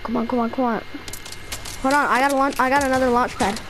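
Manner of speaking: ordinary delivery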